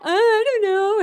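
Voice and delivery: put-on high, teary toddler voice, laughing through it; small child whimper. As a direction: imitate a crying two-year-old's mumble. Toddler answering scolding parent.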